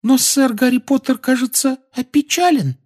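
very high shy squeak; timid elf squeak. Acted. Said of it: "робко глядя".

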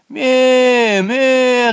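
very high, loud bleat from below; the little kid answers back; kid's bleat. A narrator's reading. Hidden kid answers its father, bleating high. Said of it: "донеслось снизу".

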